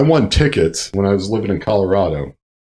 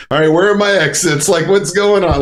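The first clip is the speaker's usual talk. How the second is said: high-pitched nervous self-talk; mock-panicked, pitched up; retold mini panic; 9 semitones higher than usual. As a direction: act out anxiously checking for a way out. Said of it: "a little mini panic, panic attack".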